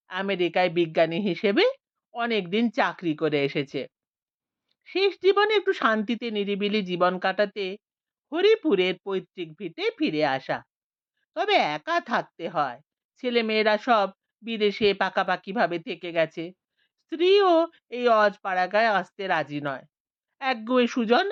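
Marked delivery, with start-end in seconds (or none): none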